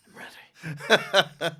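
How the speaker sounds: slow drawn-out laughing boast; hammed-up triumphant return, laughing through it; mock eager. Comedian back after four months, declaring with a laugh.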